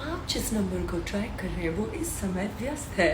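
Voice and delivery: quiet, fast recorded-announcement voice; telephone operator voice. Shown as a demonstration. Fan asks for Hindi voice, she does the phone recording. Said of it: "That's my Hindi voice".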